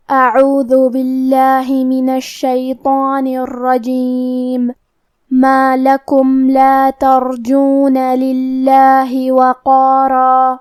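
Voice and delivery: slow, drawn-out tajweed chant; Quran recitation. Shown as a demonstration. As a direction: recite each verse slowly and melodically. Teacher recites Quran verses. (دھیمی، کھینچی ہوئی تلاوت)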